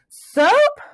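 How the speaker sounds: high, loud joyful cry; excited cry. A narrator's reading. Plug crying out the word he remembered. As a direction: burst out with the word. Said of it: "he cried".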